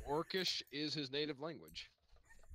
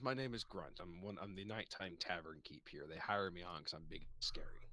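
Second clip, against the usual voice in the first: low, soft ogre tavern keeper; low and hushed, like a gentle giant introducing himself; Grunt, the nighttime tavern keep, low and soft; low ogre barkeep; 8 semitones lower, 8 dB quieter than usual. Acted.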